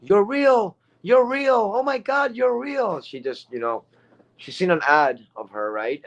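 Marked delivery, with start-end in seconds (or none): 0.0-3.0 high, squealed mock scream; screaming fan. Amazed lady spotting people from an online ad, high mock scream. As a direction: imitate an overexcited fan shrieking in disbelief. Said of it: "she was screaming".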